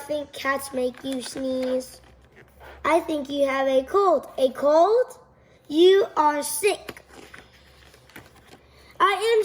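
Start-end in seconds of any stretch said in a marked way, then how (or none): none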